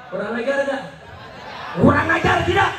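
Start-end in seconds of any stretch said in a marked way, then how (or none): 1.8-2.7 high, loud indignant call; outraged rally question; rallying shout. Real, not acted. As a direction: shout it to rouse the crowd. Rallying crowd for a response.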